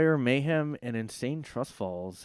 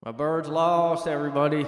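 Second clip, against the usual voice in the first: mock lament; 5 semitones higher, 7 dB louder than usual. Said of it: "repeated lament".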